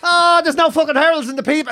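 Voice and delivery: high, moaning complaint; imitated distressed mum. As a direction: whinge down the phone as mum. Mother ringing, put out about newspaper.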